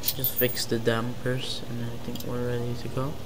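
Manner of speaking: ordinary talk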